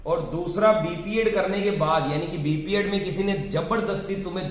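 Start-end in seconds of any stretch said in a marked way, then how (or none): none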